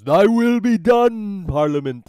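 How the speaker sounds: loud, high, slow proclamation; booming judge. Acted. Judge bowing to Parliament, booming and drawn out. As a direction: boom it out like a solemn judge.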